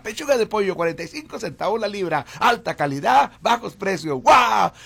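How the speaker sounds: loud, high supermarket ad announcer. Shown as a demonstration. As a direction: belt it out like a radio ad for chicken prices. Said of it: "Oye, se escucha bien". (locutor de anuncios a todo volumen)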